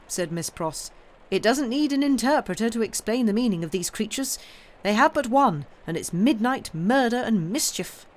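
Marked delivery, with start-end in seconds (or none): 1.3-8.1 higher and louder outrage; indignant. Acted. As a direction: denounce the mob with heat. Miss Pross denouncing the mob.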